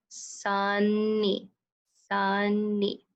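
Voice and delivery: "Sunny" as sun-nee with the n held; split into syllables. Shown as a demonstration. Teacher showing the closed first syllable, "Sunny" as sun-nee. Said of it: "keeping that first syllable closed".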